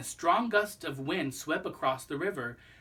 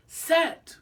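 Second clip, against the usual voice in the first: shouted; 10 dB louder than usual. Acted. Countdown called to the jumper.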